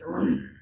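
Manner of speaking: very low revving engine noise; engine vroom. Pretend. Teacher revs up the class like a race car. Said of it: "Start your engines".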